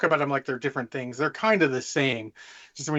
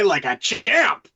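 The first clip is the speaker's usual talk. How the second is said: triumphant flourish; 6 dB louder, much slower than usual. Real, not acted. Boasting about inventing names fast.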